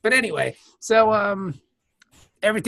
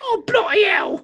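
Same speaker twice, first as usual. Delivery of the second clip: put-on British accent; 10 semitones higher than usual. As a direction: shout it like a stage Englishman. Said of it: "when we use an accent".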